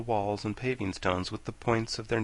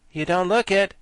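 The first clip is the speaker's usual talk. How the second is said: amused, admiring reply; 8 semitones higher, 7 dB louder than usual. A narrator's reading. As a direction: compliment her gently. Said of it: "gazing ... admiringly".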